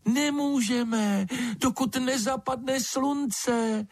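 very high, slow bat squeak; thin little creature voice. A narrator's reading. Bat tells lizard they cannot leave, thin high voice. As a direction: answer in a thin, drawn-out peep. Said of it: "hlásek tenký jako pípot ptačího mláděte".

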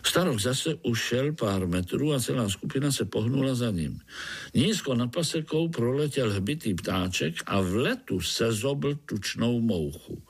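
usual speaking voice

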